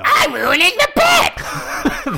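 high, slow laughing whine. Real, not acted. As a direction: giggle through the protest.

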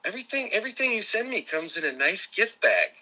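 high, chirpy take on his mom; imitating his mother. Son imitating his mother praising Amazon's gift bags, high voice. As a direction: lift the voice way up to play a delighted mother. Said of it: "She says".

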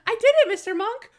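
triumphant Natalie. Retold or imitated. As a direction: shout it out like she just won.

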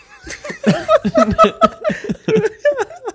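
shrill burst of laughter; high squealing laugh. Real, not acted. Reacting to drink.

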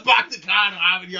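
put-on fast, loud comic Boston accent. Echoing a friend's skit impression, Boston accent. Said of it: "Take it to the Boston accent".

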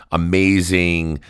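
ordinary talk

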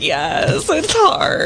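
mock heartfelt yes, sliding up high; hammed-up agreement breaking into laughter; imitating a friend's agreement. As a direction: playfully voice someone else's weary agreement. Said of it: "Catherine's like".

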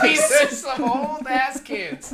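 slow, high, laughing words. Real, not acted. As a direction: stretch it out through laughter. Laughing at joke.

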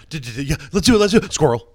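high, loud, excitable dog voice; goofy, over-excited talking Lab. Acted. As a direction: stammer eagerly, then burst out like a distractible dog. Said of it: "it would sound like the dog from Up".

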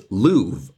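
Louvre said with a long oo like remove; old pronunciation of love. Shown as a demonstration. Teacher showing Shakespeare's love, said loov. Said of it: "the way they pronounced love back in the day was".

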